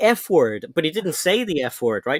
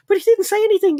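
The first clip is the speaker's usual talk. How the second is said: retold squeaky objection, pitched way up; mock-indignant high squeal; high-pitched protest; 4 dB louder, faster than usual. Retelling own protest to YouTube.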